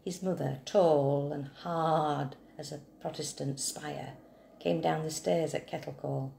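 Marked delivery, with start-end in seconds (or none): none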